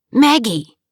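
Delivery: sharp startled call of a name; surprised shout of recognition. Acted. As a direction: call her name across the hall. Calling out to ex-wife.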